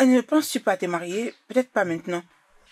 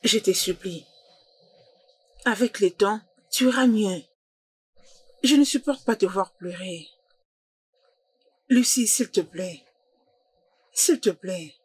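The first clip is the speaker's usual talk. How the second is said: tender, lowered voice with long pauses; gentle consoling; 2 semitones lower than usual. Acted. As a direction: comfort someone who is crying. Consoling a crying friend.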